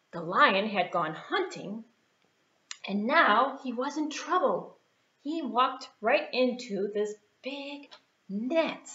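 usual speaking voice

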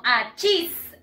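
"cheese" said like a sneeze, "a-cheese"; "a cheese" run together like "achís". Shown as a demonstration. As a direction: say "a cheese" as one sneeze word. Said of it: "Algo así".

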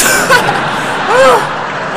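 high shouting real laughter; loud shrieking laugh. Real, not acted. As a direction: burst out laughing at your own bit. Cracks up after joke.

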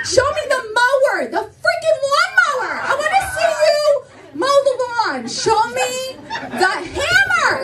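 high, emphatic comic demand; hammed-up insistence, rising to a shout; playful demand. Demanding a man prove he works.

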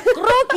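high, loud, sudden "croc"; imitated knuckle crack. Host retells how coworker cracked her colleague's finger, imitated crack. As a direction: imitate a finger joint popping.

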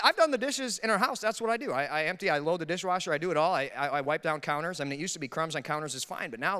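usual speaking voice

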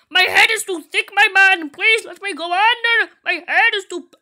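high, loud play-desperate begging; loud mock pleading. Player stuck at the bathroom stall, loud mock pleading. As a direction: beg at the top of your voice.

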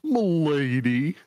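hammed-up courtly flourish; gallant milady. GM jokingly voices the investor.

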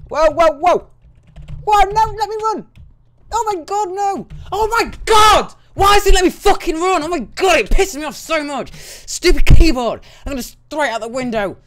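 high, loud screaming rant; furious rage. Real, not acted. Gamer screaming at the stupid keyboard in real rage. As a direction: lose it at the keyboard, yelling.

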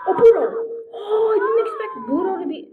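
high, loud startled cry; real surprised outcry; surprised shout. Real, not acted. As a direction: blurt it out in shock. Spots rival character suddenly.

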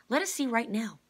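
high, loud insistence; eager demand. A narrator's reading. Kate demands to see at once, high and loud. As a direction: demand it right now.

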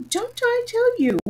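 insist, as if it was already said; impatient king. A narrator's reading. King repeating himself to Alice, impatient. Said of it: "The king patiently".

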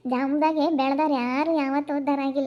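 normal talking voice

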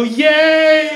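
high, loud singsong cheer; camp squeal. Retold or imitated. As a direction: squeal it flamboyantly. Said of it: "I go".

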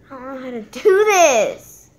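loud frustrated complaint; frustrated cry. Real, not acted. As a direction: throw it out, fed up. Frustrated with the app.

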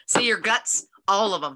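ordinary talk

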